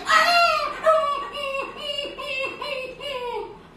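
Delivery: high howl breaking into whimpers; dog yelp. Acted. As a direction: yelp like a tripped-over puppy. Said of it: "the doggy says".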